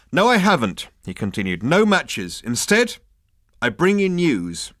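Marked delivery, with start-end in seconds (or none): 0.1-0.9 firmer, higher and louder denial; a little stronger. A narrator's reading. Ford answers the captain, a little stronger. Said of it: "be a little stronger on the subject". 1.6-4.7 raised, louder announcement; a little stronger. A narrator's reading. Ford addresses the captain with news, a little stronger. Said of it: "be a little stronger on the subject".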